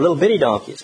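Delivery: ordinary talk, nothing marked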